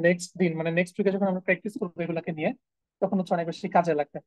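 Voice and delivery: ordinary talk, nothing marked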